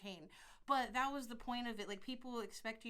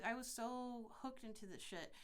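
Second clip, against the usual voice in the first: ordinary delivery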